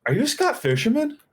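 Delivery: high-pitched mock fan question; starstruck fan. Fan approaches him in a store, starstruck. Retells being recognized by a fan.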